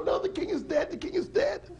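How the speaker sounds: very high, urgent shout; frantic cry. Acted. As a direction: call the crowd in panic. Lady insists the king is dead.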